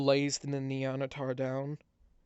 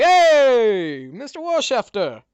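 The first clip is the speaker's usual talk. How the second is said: much higher, much louder shout; crowd cheering; 10 semitones higher, 17 dB louder, slower than usual. Acted. Tavern crowd greeting the mayor, loud high cheer. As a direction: cheer him as he enters. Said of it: "people are like".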